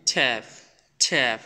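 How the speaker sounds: "ট্যাপ" as in English, falling tune; English t demo. Shown as a demonstration. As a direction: give English "ট্যাপ" as a crisp example word. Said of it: "ইংলিশে আমরা কি উচ্চারণ করি".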